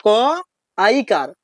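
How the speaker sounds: क ऐ कार said syllable by syllable, very slowly; teacher models the answer slowly; slow spelling demo. Shown as a demonstration. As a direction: stretch out क ऐ कार so pupils can copy. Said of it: "क्या पढ़ना है आपको?".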